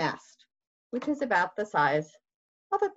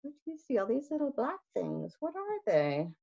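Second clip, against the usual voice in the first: softer, coaxing question; story-time question to children; 6 semitones higher, 6 dB quieter, faster than usual. Shown as a demonstration. Reading to children, hushed questioning voice. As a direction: ask the kids gently. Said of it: "asks listening children".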